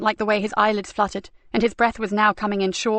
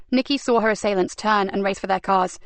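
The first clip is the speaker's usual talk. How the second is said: usual speaking voice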